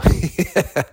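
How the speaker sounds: a short high laugh; bright chuckle before answering; amused laugh. Retold or imitated. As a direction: laugh it off, taken by surprise. Acting out his own response.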